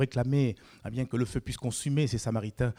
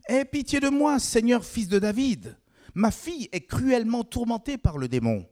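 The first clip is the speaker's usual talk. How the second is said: high, loud cry for mercy; mother begging Jesus to save her daughter, high loud plea; pleading cry; 12 semitones higher, 4 dB louder than usual. A narrator's reading. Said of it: "lui cria".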